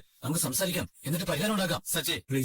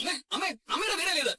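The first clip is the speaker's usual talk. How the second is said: high-pitched, frantic appeal; desperate pleading; 11 semitones higher than usual. Acted. Son begs mother not to leave, desperate pleading. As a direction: beg her, voice pitched right up.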